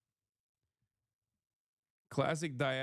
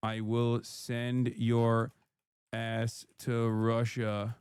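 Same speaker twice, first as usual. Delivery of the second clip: lower, very slow, each word spaced out; slow, deliberate warning; 5 semitones lower, much slower than usual. Real, not acted. Streamer warns a chatter on his third strike, very slow. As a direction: spell it out slowly like a final warning. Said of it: "slow, deliberate warning to chatter".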